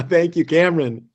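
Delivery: giddy grateful laugh; laughing thanks. Real, not acted. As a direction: still laughing while thanking. Laughing after episode release.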